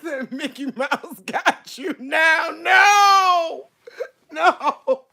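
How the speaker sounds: high crying laugh; half sob, half laugh in fright; panicked. Real, not acted.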